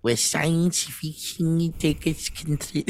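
slow, garbled mock German accent; drawn-out caricature German accent. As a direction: mangle it in a put-on German accent. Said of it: "Do it in a German accent".

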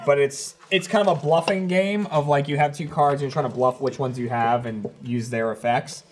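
usual speaking voice

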